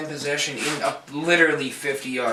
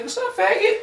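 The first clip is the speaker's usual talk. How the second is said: mock-camp greeting, pitched way up. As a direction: sing it up high like a flamboyant friend.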